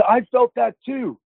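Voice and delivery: high, slow exclamation; amazed retelling. Retold or imitated. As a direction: imitate an astonished son chiming in. Son's amazed outburst, retold.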